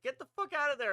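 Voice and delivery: high, loud urgent command; urgent order. Retold or imitated. Teammate ordering the player to flee, high loud mock urgency. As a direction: imitate a teammate ordering you out.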